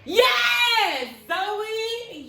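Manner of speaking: high, loud cheer of praise; excited cheer. Real, not acted. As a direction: cheer the answer out. Teacher cheering a right answer.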